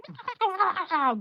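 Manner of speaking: high squawking bird cry; bird noises. Retold or imitated. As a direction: screech like a creepy bird. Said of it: "bird noises".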